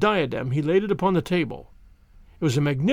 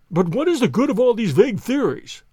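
impatient banker, higher-pitched; impatient cry; 5 semitones higher, 4 dB louder than usual. A narrator's reading. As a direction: cut in with exasperation at idle theorizing. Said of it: "cried the banker impatiently".

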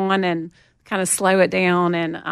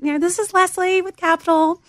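put-on Southern accent; 10 semitones higher, 4 dB louder than usual. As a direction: sing out a Southern hello on a work call. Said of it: "I had it in my Southern accent".

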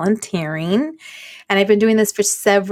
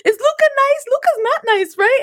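very high-pitched mom imitation; calling for backup; 14 semitones higher than usual. Mom calling another mom to back her up, insistent.

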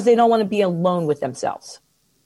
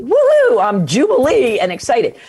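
mock jubilation; 3 semitones higher, 5 dB louder than usual. Coach showing the wrong leap, mock ecstatic. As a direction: burst out in overblown mock glee. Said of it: "you don't wanna go from there to say".

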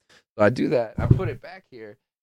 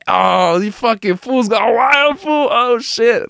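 much higher, hyped imitation of Southern California fans; imitating hyped fans; 12 semitones higher, slower than usual. Fans from Southern California greeting him, hyped higher imitation. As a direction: play an amped-up fan spotting the costume. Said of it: "people from Southern California ... just be like".

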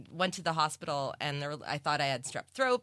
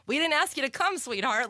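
higher, louder mock condescending sweetheart; snide city voice; 8 semitones higher, 4 dB louder than usual. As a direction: talk down like a fake-sweet local.

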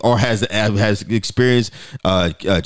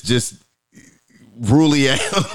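ordinary delivery

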